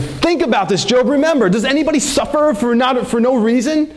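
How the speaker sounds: a hint of a self-righteous accuser lecturing Job; accusing Eliphaz. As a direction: press Job as a smug friend would. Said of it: "and Eliphaz is saying".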